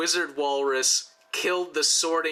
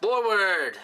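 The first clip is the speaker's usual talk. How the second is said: battle cry; 5 semitones higher, slower than usual. Acted. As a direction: cry out like a warrior leading the charge. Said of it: "he's gonna be yelling".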